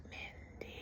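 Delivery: very soft, ghostly whisper. Retold or imitated. Unseen somebody whispering her nickname in the basement, soft whisper. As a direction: whisper the name as if close to an ear. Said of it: "Somebody whispered my nickname".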